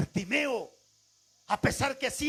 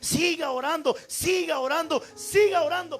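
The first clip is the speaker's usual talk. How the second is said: high, loud exhortation building to the last repeat; insistent preaching; 5 semitones higher, 5 dB louder than usual. Real, not acted. Preacher urging congregation to keep praying, insistent exhortation. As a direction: hammer each repetition with rising force.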